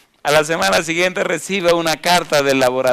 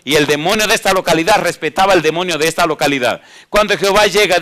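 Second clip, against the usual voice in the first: loud, rising preacher's voice; fiery preaching; 3 semitones higher, 5 dB louder than usual. Acted. Preacher pressing the congregation, at full force.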